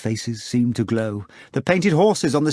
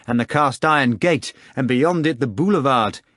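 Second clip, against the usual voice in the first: ordinary delivery, nothing marked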